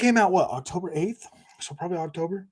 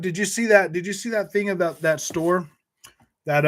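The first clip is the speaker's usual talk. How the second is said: ordinary talk, nothing marked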